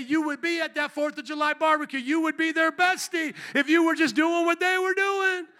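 high, loud preacher's build; preaching at full force. Real, not acted. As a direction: drive it home to the congregation, loud and high.

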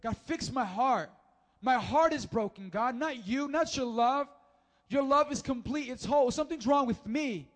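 fervent preacher's plea; impassioned prayer. Acted. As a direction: cry out to God with full conviction. Impassioned first-person plea to God.

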